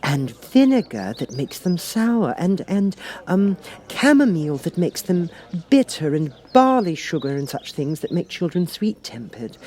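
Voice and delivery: delight at a clever new rule; pleased Alice. A narrator's reading. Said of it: "very much pleased".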